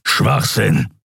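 mild growl of contempt. A narrator's reading. Kero dismisses the captive's story, growling. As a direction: dismiss it with a grumble. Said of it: "grollte Kero".

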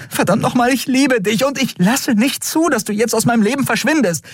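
high, urgent declaration of love; impassioned high-pitched insistence; desperate outburst. A narrator's reading. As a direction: blurt it out fiercely, speeding up.

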